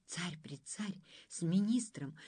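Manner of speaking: ordinary delivery, nothing marked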